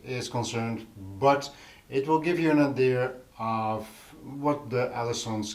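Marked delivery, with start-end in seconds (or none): none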